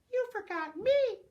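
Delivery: high squeaky rat. Acted. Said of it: "squeaked a rat".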